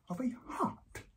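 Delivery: near-whispered, stunned reveal; hushed disbelief. Acted. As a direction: let it out almost voiceless. First, stunned reveal of shaved shape.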